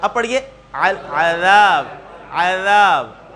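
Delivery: slow al-adhab, tongue tip on teeth; العذاب with dhal. Shown as a demonstration. Teacher shows dhal sound to student, slowly. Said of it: "زبان کی نوک کو کہاں لگائیں گے".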